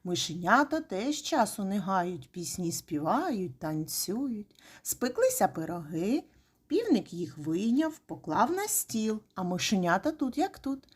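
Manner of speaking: plain reading voice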